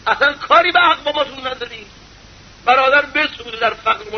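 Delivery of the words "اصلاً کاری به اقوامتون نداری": ordinary delivery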